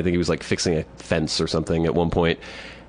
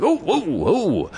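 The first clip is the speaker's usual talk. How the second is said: put-on alarmed old man; 12 semitones higher, much slower than usual. Old man stopping him, retold.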